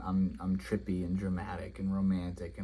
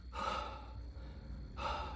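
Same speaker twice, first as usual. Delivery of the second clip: long breathy puff, imitating exhaustion; soft, drawn-out huff of mock exhaustion; out-of-breath exhale; 14 dB quieter than usual. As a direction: mime being winded with a long exhale. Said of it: "you know, out of breath".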